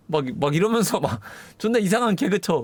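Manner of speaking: usual speaking voice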